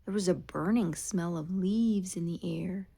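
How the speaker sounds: ordinary delivery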